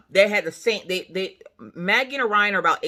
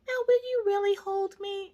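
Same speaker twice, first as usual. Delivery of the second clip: put-on high, softer pleading child; imitated little girl; 14 semitones higher, 5 dB quieter than usual. Girl on dad's lap, imitated high plea.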